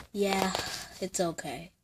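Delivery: quiet and down, dropping away; glum. Acted. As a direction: say it like your heart sank. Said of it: "Why you say it like that?".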